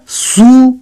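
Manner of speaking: "सु" held long on its own; isolated, drawn-out "सु"; one syllable. Shown as a demonstration. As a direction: stretch "सु" as a separate syllable. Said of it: "एक syllable".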